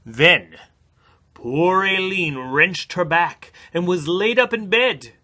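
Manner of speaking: put-on storybook narration in an Irish accent; Irish story narration. A narrator's reading. Reading to listeners, Irish-accented story narration. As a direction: spin the tale like an Irish storyteller.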